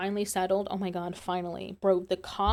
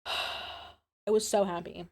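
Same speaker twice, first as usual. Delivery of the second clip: contented sigh; much slower than usual. Real, not acted. Sighing happy line.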